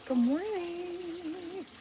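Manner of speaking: high chirpy good morning; sing-song greeting. Real, not acted. Greeting viewers in a high sing-song voice. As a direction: sing it out brightly.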